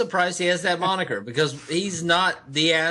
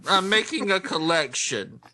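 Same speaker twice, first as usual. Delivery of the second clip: whiny voice; 2 semitones higher, slower than usual. Pretend. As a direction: keep the nasal whine going. Whiny voice game continues.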